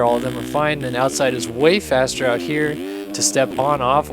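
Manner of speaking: ordinary delivery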